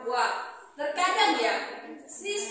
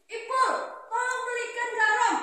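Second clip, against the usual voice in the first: mother's request; 8 semitones higher than usual. Retold or imitated. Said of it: "contoh misalnya gini".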